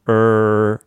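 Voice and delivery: "うー" as a long er with tongue curled; American er. Shown as a demonstration. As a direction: curl the tongue and hold "うー". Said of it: "舌を丸めるんですね".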